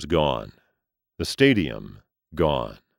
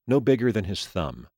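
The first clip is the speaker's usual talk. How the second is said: usual speaking voice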